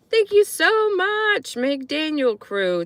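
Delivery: high, slow gushing thank-you; excited thanks. Real, not acted. Streamer thanking donors, high and gushing. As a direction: sing out the thanks.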